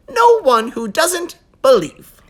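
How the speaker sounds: usual speaking voice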